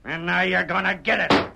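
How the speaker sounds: ordinary talk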